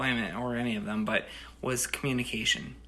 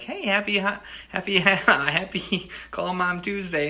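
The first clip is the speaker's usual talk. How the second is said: laughing greeting; 7 semitones higher, 4 dB louder than usual. Retold or imitated. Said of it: "I made sure to call Mom".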